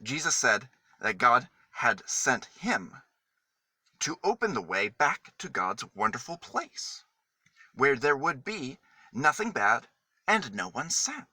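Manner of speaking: plain reading voice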